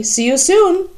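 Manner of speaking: put-on a lofty old-lady goodbye; Hilda's voice. A narrator's reading. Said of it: "Hilda says to Charlie".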